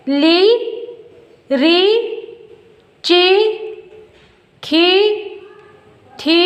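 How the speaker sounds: ली, री, ची, खी, थी each with a long held ee; teacher sounding out ली, री, ची, खी, थी one by one, vowel stretched; ली, री, ची, खी, थी as separate drawn-out syllables, a pause after each; pronunciation demo. Shown as a demonstration. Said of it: "इसका हम उच्चारण कैसा करेंगे".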